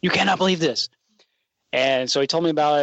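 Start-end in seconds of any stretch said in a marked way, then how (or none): 0.0-0.9 higher-pitched imitation of giddy excitement. Student retelling the superintendent back with news, giddy. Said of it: "like, giddy".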